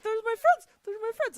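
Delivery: high, loud fangirl squeal; squealing excited. Retold or imitated. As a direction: gush it out, way up high. Gushing quote, huge pitch jump.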